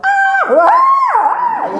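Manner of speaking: high, loud mock scream on the drops; hammed-up shriek of fright. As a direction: shriek like you're on the roller coaster. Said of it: "and you go".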